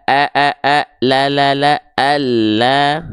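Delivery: "a, a, a, la, la, la" as thin smiling A, then a long "Allah"; tipis, sedikit senyum. Shown as a demonstration. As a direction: drill the light open A in "a", "la", "Allah", held. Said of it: "sedikit senyum". ("a, la, Allah" tipis, sedikit senyum)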